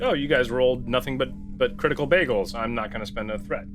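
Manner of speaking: normal talking voice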